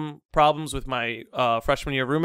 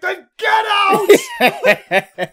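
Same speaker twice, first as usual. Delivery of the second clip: high, drawn-out mock-furious yell; furious dismissal; 8 dB louder, much slower than usual. Head of security kicking the student out, yelling. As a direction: bellow it slowly to throw someone out. Retold interrogator throwing student out.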